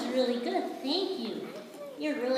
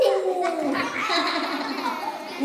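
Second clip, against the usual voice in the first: loud high delighted laughter; joyful laugh; 5 semitones higher, 6 dB louder than usual. Acted. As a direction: laugh with pure glee. Joyful laughter after flying game.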